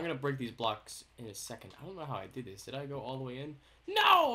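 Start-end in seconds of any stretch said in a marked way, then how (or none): 3.9-4.3 sudden loud high cry; startled no. Real, not acted. Fears he dug too far, cries out. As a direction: blurt out alarm at a slip.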